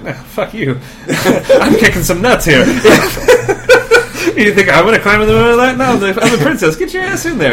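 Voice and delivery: laughing, defiant princess, hammed up. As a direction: act a bossy princess cracking up. Voicing the princess in a story.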